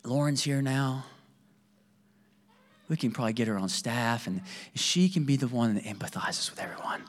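drops to a soft near-whisper; a hushed, mock-serious inner thought; hushed aside. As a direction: confide the joke under your breath. Joking inner thought about wife.

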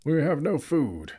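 a hint of the wizard. A narrator's reading. Gandalf keeps speaking.

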